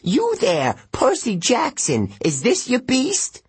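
put-on high, fussy old satyr voice, outraged; a pompous old goat-man scolding; cranky old satyr. A narrator's reading. Satyr addresses Percy.

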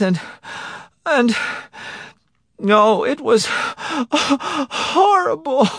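high-pitched account broken by gasps; sobbing helplessly. A narrator's reading. Wife telling her husband of the abduction, sobbing. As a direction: pour it out through panicked sobs. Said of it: "sobbing helplessly".

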